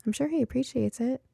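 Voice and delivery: ordinary delivery, nothing marked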